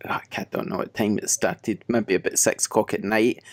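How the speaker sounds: ordinary talk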